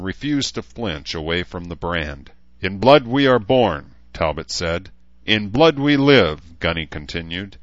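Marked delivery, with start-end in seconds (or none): 2.6-3.9 loud, raised ceremonial chant; ritual declamation. Acted. Talbot pressing the brand, loud ritual declamation. 5.3-6.4 loud, high, slow creed; ritual declamation. Acted. Gunny continuing the branding rite, loud and slow declamation.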